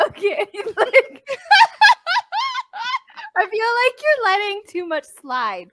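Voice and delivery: laughing. Real, not acted.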